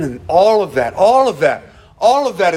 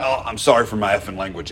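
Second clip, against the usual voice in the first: lower, fast, gruff apology with a slipped cuss; a hint of a rough man cussing mid-apology; quick gruff apology with a slip; rough old man cussing; 7 semitones lower, 4 dB quieter, faster than usual. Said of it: "it always came out".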